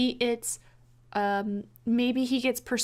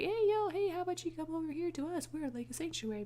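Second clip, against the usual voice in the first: higher, much softer and fast, like a distant radio call; a stranger calling in over a crackly radio; 6 semitones higher, 9 dB quieter, faster than usual. Retold or imitated. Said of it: "comes over the radio and is like".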